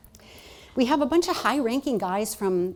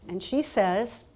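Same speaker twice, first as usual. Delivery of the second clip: ordinary talk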